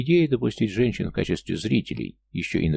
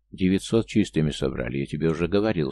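ordinary delivery, nothing marked

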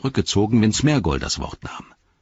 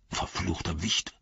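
growling; 9 dB quieter than usual. Acted. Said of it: "knurrte er".

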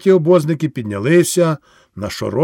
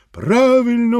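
crowd shout; 9 semitones higher than usual. Acted. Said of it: "гукає народ".

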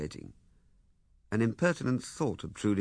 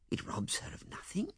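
whisper; 12 semitones higher, 9 dB quieter than usual. Acted. Pleading quietly.

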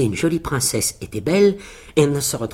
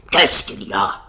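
startled giant; 5 semitones lower, 4 dB louder than usual. Acted. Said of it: "s'écria celui-ci".

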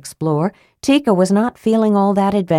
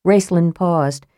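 plain reading voice

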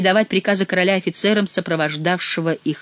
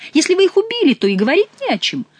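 loud, much higher sneer; mocking; 13 semitones higher, 5 dB louder than usual. Chicot scoffing at the courtiers, loud and high. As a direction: scoff at them.

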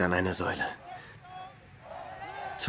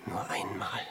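voiceless, longing whisper, far softer; hungry whisper; 3 semitones lower, 12 dB quieter than usual. Acted. Starving hermit whispering his longing for food. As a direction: breathe it out like a starving man's wish.

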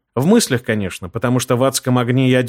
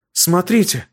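exclaiming; 6 semitones higher, slower than usual. A narrator's reading. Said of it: "воскликнула Ирита".

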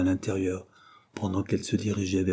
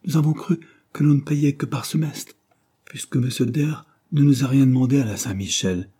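interrupting; 5 semitones higher, 4 dB louder than usual. A narrator's reading. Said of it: "interrompit Jane".

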